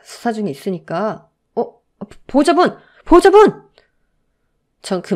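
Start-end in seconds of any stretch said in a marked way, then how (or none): 1.5-2.8 raised call for the guardian. A narrator's reading. Nurse calling the mother in the hospital, loud. 3.0-3.7 much higher, much louder cry for the guardian; urgent shout. A narrator's reading. Nurse shouting for the fainting mother, urgent.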